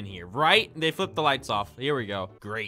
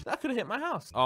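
ordinary delivery

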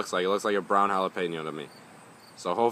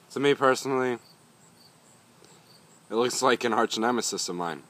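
normal talking voice

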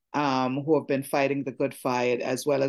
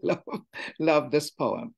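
ordinary delivery

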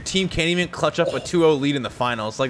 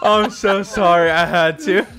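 laughing apology; 2 semitones higher than usual. Real, not acted. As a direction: say sorry through laughter.

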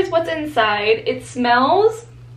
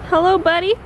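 cooing at a lizard; 6 semitones higher, 3 dB louder than usual. Real, not acted. Greets a little lizard friend.

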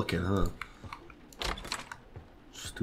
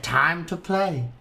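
1 slow prince voice; 6 semitones higher, much slower than usual. Acted. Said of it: "the prince said".

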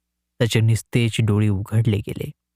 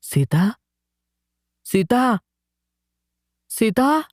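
rising calls, each one higher; calling out; 8 semitones higher, slower than usual. A narrator's reading. Husband calls for his missing wife, rising higher each time. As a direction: call her name into the empty hut. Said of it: "त्यानं हाका मारल्या".

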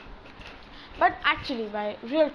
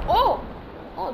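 surprised oh; 5 semitones higher than usual. Real, not acted. Surprised by bridge building.

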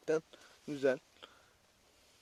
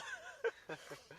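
short laugh; 10 dB quieter than usual. Real, not acted. Laughs after burning mouth.

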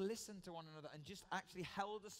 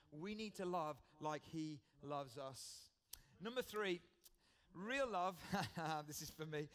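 usual speaking voice

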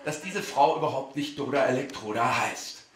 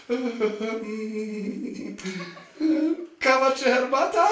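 flustered fan; 6 semitones higher, much slower than usual. Retold or imitated. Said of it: "ganz bescheuert da".

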